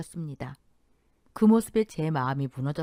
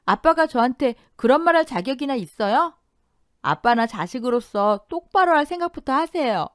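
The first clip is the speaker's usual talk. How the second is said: angry retort; 7 semitones higher, 7 dB louder than usual. A narrator's reading. Daughter confronts father.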